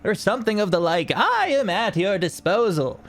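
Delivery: high, chipper half-dwarf merchant pitching wares; jolly tinkerer. Acted. Tinkerer pitching his wares to the party, high and chipper. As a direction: sell it like a cheerful travelling tinker.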